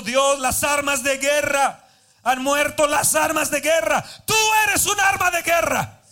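building to a loud shouted preach over music; fervent shouting preacher over music; preaching at full force. Real, not acted. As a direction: cry it out to the congregation.

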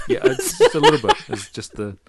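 giggling reply; laughing. Real, not acted. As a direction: answer while laughing. Laughing while answering.

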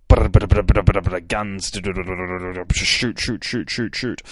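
rattling machine-gun noises, loud at first; vocal gun sound effects, starting with a loud rattle; imitated gunfire. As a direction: mimic rapid bursts of gunfire. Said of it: "that's the sound it makes".

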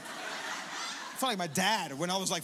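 ordinary talk

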